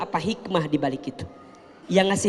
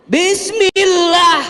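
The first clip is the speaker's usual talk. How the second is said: high, loud and slow Bismillah; loud drawn-out Bismillah; 11 semitones higher, 7 dB louder, much slower than usual. Shown as a demonstration. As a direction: call out Bismillah slowly and loudly. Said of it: "kencengin suaranya".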